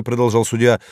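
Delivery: ordinary delivery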